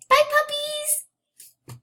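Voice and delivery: high, slow, stretched-out squeal; high sing-song bye to the pets; squeaky goodbye. Real, not acted. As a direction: coo a drawn-out farewell to the puppies. Saying goodbye to her pets.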